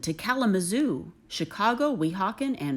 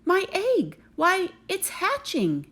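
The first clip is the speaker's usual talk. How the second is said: excited shout; 10 semitones higher than usual. Acted. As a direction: shout it as the egg starts to move. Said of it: "shouted Horton".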